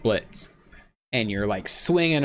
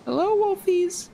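very high, put-on friendly voice; high silly greeting. Player greeting game wolves as Indy, high silly voice. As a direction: squeak a friendly hello way up high.

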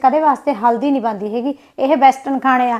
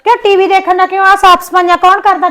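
angry retort; 6 semitones higher, 6 dB louder than usual. Acted. Sisters-in-law quarrel over chores.

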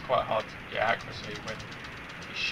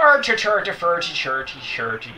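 exaggerated Irish accent, lightly done; hammy Irish accent impression; 2 semitones higher, 6 dB louder than usual. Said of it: "that's my impression of an Irish accent".

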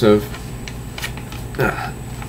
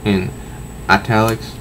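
ordinary talk

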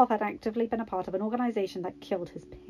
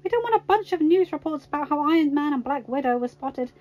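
raised, emphatic protest; exclaiming; 6 semitones higher, 4 dB louder than usual. A narrator's reading. Natasha warning Tony about news reports, exclaiming. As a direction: argue it higher and a bit louder.

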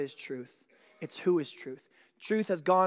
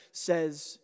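ordinary talk

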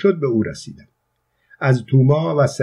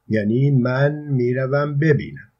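ordinary delivery, nothing marked

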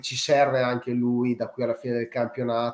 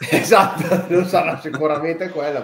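laughing, louder and higher; 5 semitones higher, 7 dB louder than usual. Real, not acted. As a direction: laugh through the words. Laughing at joke.

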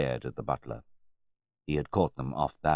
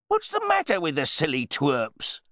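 loud, high-pitched exasperation; blustering, boorish wine snob; pompous host grumbling; 13 semitones higher, 6 dB louder than usual. A narrator's reading. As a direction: complain indignantly about the guests. Host complains about his guests.

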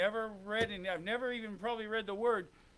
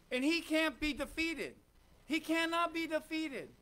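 rising, louder preaching build; preaching climax; 4 semitones higher, 4 dB louder than usual. Acted. Preacher to congregation, building louder and higher. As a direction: drive it home from the pulpit.

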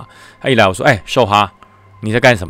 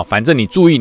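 ordinary talk

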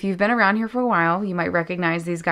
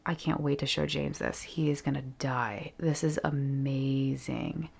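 hushed whisper; 3 semitones lower, 7 dB quieter than usual. Real, not acted. Admiring the fur detail, whispering in wonder. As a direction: whisper it like it's precious.

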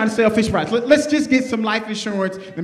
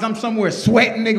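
usual speaking voice

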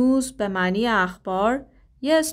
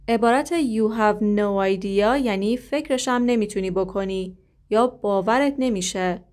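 usual speaking voice

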